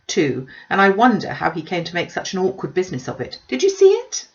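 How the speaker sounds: high, loud, breathless gossiping mother; gushing Mrs Bennet. Acted. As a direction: pour out the news like an excited busybody. Mrs Bennet voiced by narrator.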